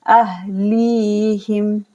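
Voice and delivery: अहलीहिम with the ली held long; slow recitation; drawn-out li. Shown as a demonstration. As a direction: stretch ली in अहलीहिम. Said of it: "यहाँ पर मद करेंगे".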